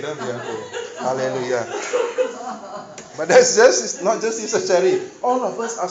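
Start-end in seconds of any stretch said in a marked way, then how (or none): 0.0-0.6 soft comfort. Acted. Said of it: "repeats comfort".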